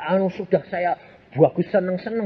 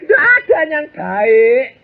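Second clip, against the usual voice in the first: emphatic declaration; 10 semitones higher, 6 dB louder, slower than usual. Acted. Preacher hammers the point.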